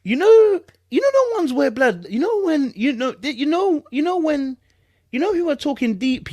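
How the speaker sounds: high, loud exasperated pleading; exasperated rant. Real, not acted. Streamer rants to viewers in high exasperation. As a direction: throw up your hands in disbelief.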